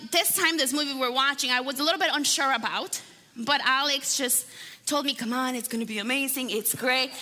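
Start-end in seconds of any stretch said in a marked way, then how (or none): 5.1-7.1 a hint of a put-on lower voice; imitating husband coaxing. Wife imitating Alex persuading her to watch a movie, mock coaxing. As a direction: lightly mimic someone talking you into it. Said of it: "why I'm talking like that".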